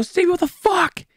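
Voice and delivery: high, outraged call to Stevie; shouting for the brother who did it; angry yell at Stevie. Retold or imitated. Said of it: "I go".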